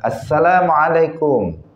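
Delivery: slow, high "Assalamualaikum" with every syllable; proper greeting. Shown as a demonstration. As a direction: say "Assalamualaikum" in full, slowly. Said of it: "Tetapi mestinya apa?".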